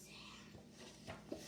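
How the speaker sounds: whispered aside, slow and hushed. Real, not acted. Child reading aloud, a hushed whispered aside about the sad story. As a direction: breathe it out like a secret. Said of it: "This is going sad".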